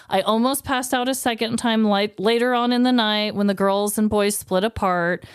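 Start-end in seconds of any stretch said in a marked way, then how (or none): none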